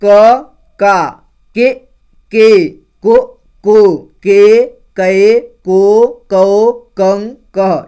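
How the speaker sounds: loud, slow barakhadi chant; loud syllable drill. Shown as a demonstration. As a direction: call each syllable out for the class. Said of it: "तो देखिए". (ज़ोर से धीरे-धीरे बारहखड़ी बोलना)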